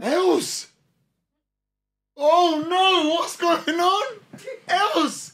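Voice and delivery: loud high call across the room. Real, not acted. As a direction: shout her name to get her attention. Calling out to Els.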